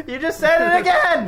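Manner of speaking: high, loud laughing yell; laughing shout. Real, not acted. Laughing outburst at a slip.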